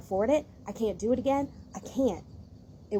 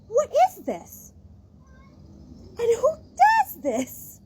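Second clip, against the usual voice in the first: incredulous outrage; 12 semitones higher, 5 dB louder than usual. Real, not acted. Outraged at kittens being endangered.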